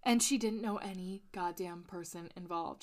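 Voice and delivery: normal talking voice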